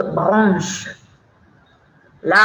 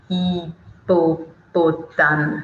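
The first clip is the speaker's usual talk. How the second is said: "Hippopotame" slow and lower, syllable by syllable; drawn-out syllables; 4 semitones lower, much slower than usual. Shown as a demonstration. Teacher showing children a long word, syllable by syllable. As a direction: break "Hippopotame" into separate, unhurried syllables. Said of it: "c'est long aussi".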